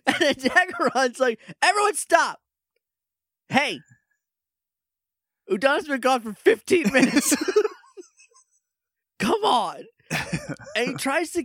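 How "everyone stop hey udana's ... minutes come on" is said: high, raised-voice call for order; mock exasperated shout. Daggeron scolding the bickering Rangers, mock exasperated shout. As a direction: break up the squabble, exasperated and pitched high. Daggeron breaks up the acted quarrel.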